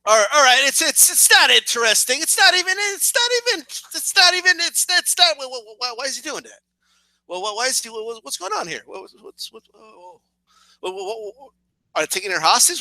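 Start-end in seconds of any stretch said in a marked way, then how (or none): 5.4-11.5 softer and softer confused whys; hushed baffled muttering. Retold or imitated. Viewer hooked on the novela plot, muttering softer and softer. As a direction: mutter to yourself, glued to the screen.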